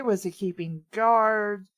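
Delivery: ordinary talk, nothing marked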